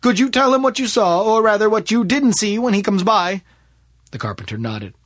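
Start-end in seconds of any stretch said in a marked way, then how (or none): 0.0-3.4 lightly acted detective, louder and higher; inquiring detective. Detective asking a carpenter to tell the constable, lightly acted raised voice. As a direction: put a raised, projected question to a witness.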